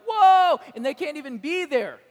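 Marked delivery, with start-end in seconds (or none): 0.0-0.6 overwhelmed cry of whoa, much higher and louder; awed whoa. Retold or imitated. Priests in the temple, stunned: loud awed whoa. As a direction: gasp it out, stunned by the glory. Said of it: "and they're like".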